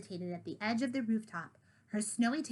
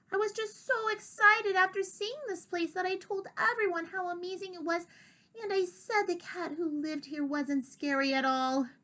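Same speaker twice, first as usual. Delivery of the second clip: guilty little cat; 8 semitones higher than usual. Acted. Pixie apologising to Diggory in a high small voice. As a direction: squeak out a sorry apology.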